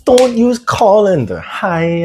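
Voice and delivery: put-on doing Uncle Roger's accent, drawn out and high; Uncle Roger impression. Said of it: "what line can I do from, like, Uncle Roger?".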